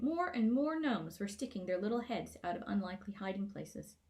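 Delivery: ordinary delivery, nothing marked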